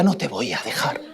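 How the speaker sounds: voz baja y suave de padre; susúrralo como un padre que promete; suave y tierno. Acted. Abba responde al hijo. (en voz baja, con ternura)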